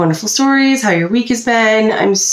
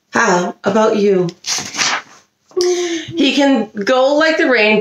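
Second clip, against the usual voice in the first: usual speaking voice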